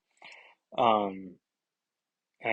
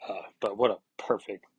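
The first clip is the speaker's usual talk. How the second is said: normal talking voice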